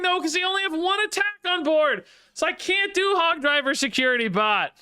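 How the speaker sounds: high-pitched exasperated disbelief; frustrated outburst. Real, not acted. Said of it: "I'm unreasonably angry now".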